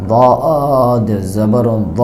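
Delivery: very slow, দ said with a dark, rounded vowel; drawn-out tajweed spelling of দদ জবর দ; দ stretched with a full-mouthed heavy a; heavy দদ recitation. Shown as a demonstration. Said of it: "পরিপূর্ণ আকারের মতো হবে না".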